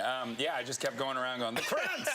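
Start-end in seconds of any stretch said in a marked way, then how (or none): 1.6-2.1 high gleeful call of the nickname; a playful high shout; excited announcing. Retold or imitated. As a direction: proclaim it like a grand title. Goes around proudly announcing nickname.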